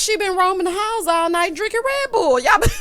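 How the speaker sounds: loud, high teasing scold; playful loud scolding. Acted. Host teasing the housewives for staying up all night, loud playful scolding. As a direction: call them out loud and high, half laughing.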